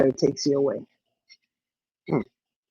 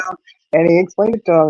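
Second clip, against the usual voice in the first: ordinary talk, nothing marked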